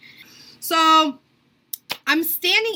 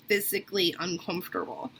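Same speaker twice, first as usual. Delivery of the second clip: usual speaking voice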